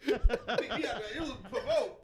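high slow laughing words; laughing protest. Real, not acted. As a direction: protest while cracking up.

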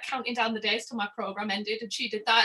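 ordinary talk

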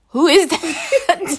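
stretched-out incredulous question; baffled mock reaction. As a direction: imitate someone baffled, laughing through it. Retelling listeners' baffled reaction.

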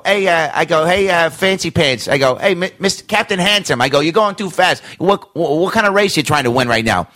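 high, teasing cartoon cop voice; mock cop. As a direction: needle the star like an overeager beat cop. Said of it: "I was the New York cop".

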